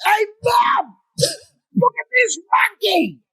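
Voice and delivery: put-on high excited child shout; kid yelling. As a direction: yell like a thrilled little boy spotting something. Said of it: "he yells out".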